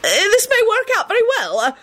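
high, flustered minister making a demand; Fudge. A narrator's reading. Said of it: "he demanded".

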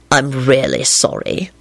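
low, slow apology; deep-pitched, unhurried remorse; repentant. A narrator's reading. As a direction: apologise slowly and contritely. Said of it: "Lupin said repentantly".

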